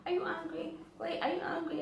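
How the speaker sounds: high, anxious pleading. Retold or imitated. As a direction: chase him with a worried high voice. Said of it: "and then I'm like".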